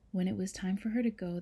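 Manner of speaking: plain reading voice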